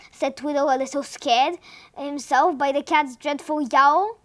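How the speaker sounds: plain reading voice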